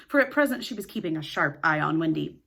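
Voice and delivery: plain reading voice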